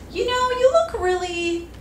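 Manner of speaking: high, sweet mock-polite old lady. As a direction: coo it like a tactful older woman. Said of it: "she's like".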